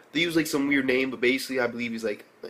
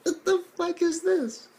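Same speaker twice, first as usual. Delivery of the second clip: mock disbelief. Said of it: "And I'm like".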